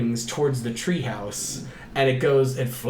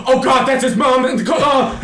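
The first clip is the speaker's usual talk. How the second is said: high, loud horrified outburst; Ada; 9 semitones higher, 7 dB louder than usual. Retold or imitated. Viewer spots his mom in the corner, loud mock-horrified shout. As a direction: shriek it as an imitated panicked viewer. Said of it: "Ada's first reaction was".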